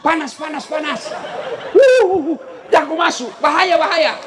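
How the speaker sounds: put-on yelling to keep a kid out of the heat; retold yell. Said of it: "Dia teriak".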